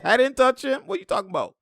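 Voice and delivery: high, whiny mock protest of innocence; mock protesting player. Mocking the fouled player's denial, high and whiny. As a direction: squeak it out like a player denying the foul. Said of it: "He's like".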